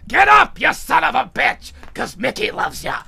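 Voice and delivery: put-on shouted, high opening, settling into old trainer's voice; Mick. Rocky hears his dead mentor urging him up, shouted impression. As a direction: bellow the first words as the remembered mentor. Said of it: "remembers the voice of his old mentor".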